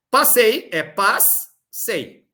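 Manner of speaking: "Passei" broken into "pas, sei", slowly; slow syllables. Shown as a demonstration. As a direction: break the word at the double S. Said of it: "E não separar como se fosse". ("Passei" dividido devagar: "pas, sei")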